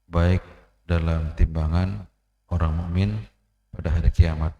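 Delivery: loud, slow pulpit recitation of the hadith; slow, loud sermon opening; forceful preaching. Acted. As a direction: proclaim it to the congregation, weighing each phrase.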